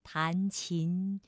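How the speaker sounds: very slow, solemn reading; slow lyrical recital fading away; poem recitation. Acted. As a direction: recite the classical poem slowly, lingering.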